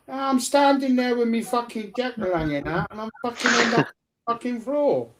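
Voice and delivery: high-pitched, aggrieved rant; indignant protest. Retold or imitated. Child protesting to parents after falling, indignant.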